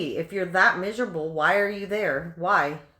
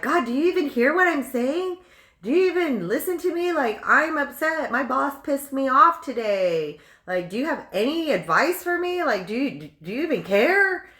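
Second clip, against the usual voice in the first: exasperated complaint; 6 semitones higher, 4 dB louder than usual. Retold or imitated. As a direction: nag like a girlfriend nobody listens to. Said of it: "we're like".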